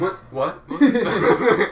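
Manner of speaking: high, loud startled shout. Pretend. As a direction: jolt awake with a shout. Startled out of a daze.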